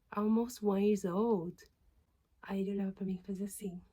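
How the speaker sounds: whispered retelling, very soft throughout; hushed whisper. Retold or imitated. Recalling muttering sums beside a stranger, whispered very softly. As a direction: let it all fall to a whisper.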